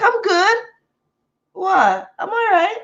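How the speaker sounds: high, dazed denial; high stoned reassurance; insisting fine. Retold or imitated.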